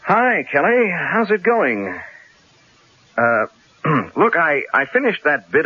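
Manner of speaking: bright, overly friendly phone greeting, slowing on the question; forced cheer. Acted. Columnist phoning his angry editor, forced cheerful greeting. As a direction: sweeten up the boss you're dodging.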